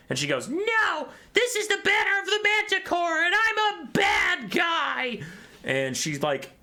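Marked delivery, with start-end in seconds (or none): 0.5-5.2 very high, loud villainess voice; cartoon villain shriek. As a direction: shriek it like a hammed-up evil priestess. Retold villainess shouts her confession.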